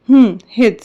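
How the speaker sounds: hesitant reply, broken by a pause; faltering. A narrator's reading. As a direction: falter before confirming it. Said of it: "Rishabh अडखळत बोलतो". (अडखळत)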